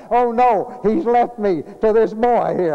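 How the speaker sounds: high, loud mock alarm of an old father; imitated panic. Dying father panicking as his son leaves, loud and high. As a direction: cry it out like a frightened dying dad.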